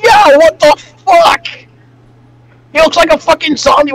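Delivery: startled outcry at a creepy face; shocked shout. Real, not acted. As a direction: yell it out in disbelief.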